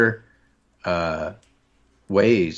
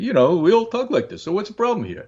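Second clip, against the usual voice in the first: higher-pitched, hammed-up New York street talk; New York street accent; 11 semitones higher than usual. New Yorker showing how we all talk, exaggerated street accent. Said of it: "because the way they talk".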